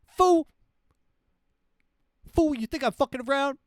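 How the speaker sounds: high, loud, fast furious yell; ranting president. Retold or imitated. Future president yelling at citizens about aliens, furious high shout. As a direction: bellow at the crowd like an unhinged leader. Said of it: "That's the president".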